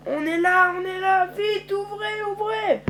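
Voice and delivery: high, urgent call to open. Acted. Arriving at the base, calling high and urgent to open. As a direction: yell at the gate to open up.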